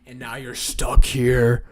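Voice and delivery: mock sinister gloat; menacing. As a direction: drag it out menacingly. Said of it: "They're like".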